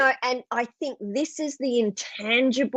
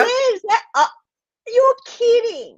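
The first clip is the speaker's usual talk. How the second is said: much higher, near tears with amazement; real astonished "you're kidding"; moved host reacting to a hospital check-in story; amazed disbelief; 13 semitones higher, 5 dB louder than usual. Real, not acted. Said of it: "I just got chills with that".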